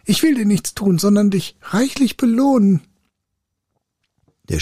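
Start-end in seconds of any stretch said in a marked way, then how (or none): 0.0-2.8 high, urgent spirit voice; high-pitched begging captive; pleading spirit. A narrator's reading. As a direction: call out desperately to bargain. Captive spirit promises reward.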